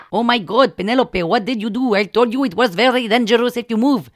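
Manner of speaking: put-on loud Indian-accented reproach; alarmed scolding healer. Healer scolds patient who flinched, loud Indian accent. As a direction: scold her for moving, loudly.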